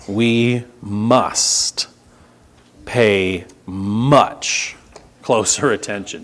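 must and pay much closer attention set apart by long pauses; weighty pause-laden stress on we must pay much closer attention; slow emphatic reading. Shown as a demonstration. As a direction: lean hard on must with pauses between words. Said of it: "Did you notice the emphasis".

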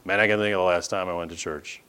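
low, soft, fast admission; lightly acted sheepish dropout reply; listless student. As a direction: mumble it off, mock apathetic. Acts out student admitting neglect.

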